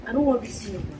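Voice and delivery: ordinary talk, nothing marked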